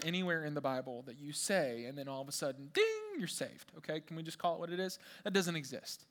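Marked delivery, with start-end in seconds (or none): 2.7-3.2 high, ringing "ding"; mock bell ding. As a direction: chime it like a sudden bell. Imitates instant-salvation bell.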